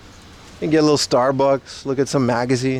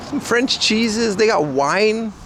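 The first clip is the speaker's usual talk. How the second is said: excited; 6 semitones higher than usual. Real, not acted. Excited praise of French food.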